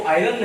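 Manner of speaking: आयरन said as eye-run with a clear r; आयरन as "i-ron", r spoken; wrong iron, r sounded. Shown as a demonstration. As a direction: demo the wrong way: आयरन with the r pronounced. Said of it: "इसको आयरन नहीं बोलते हैं".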